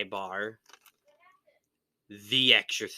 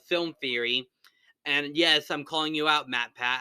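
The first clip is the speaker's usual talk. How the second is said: ordinary delivery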